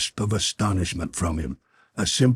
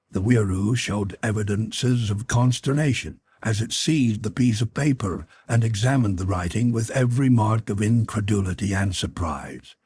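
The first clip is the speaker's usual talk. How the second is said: ordinary delivery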